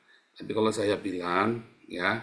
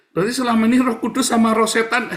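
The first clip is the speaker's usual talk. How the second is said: louder and much higher, laughing through the words; 9 semitones higher, 8 dB louder than usual. Real, not acted. Preacher ridiculing superstition to the congregation, laughing loudly. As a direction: laugh at the absurd idea.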